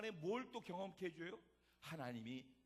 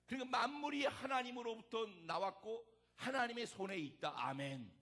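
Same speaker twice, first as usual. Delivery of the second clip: loud, raised preaching voice; emphatic preaching; 4 semitones higher, 6 dB louder than usual. Real, not acted. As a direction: drive it home to the congregation. Preaches at full force.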